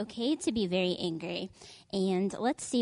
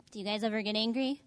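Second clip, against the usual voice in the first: usual speaking voice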